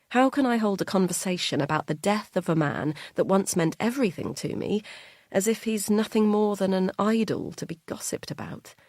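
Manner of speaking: usual speaking voice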